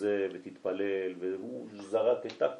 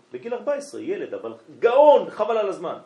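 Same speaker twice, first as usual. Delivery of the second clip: high, loud, marvelling burst; loud, high admiring exclamation; amazed praise; 9 semitones higher, 10 dB louder than usual. Real, not acted. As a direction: rave about him, voice soaring. Marvelling at the boy genius.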